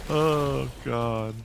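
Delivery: ordinary talk, nothing marked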